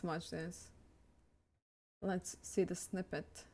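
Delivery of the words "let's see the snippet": ordinary talk, nothing marked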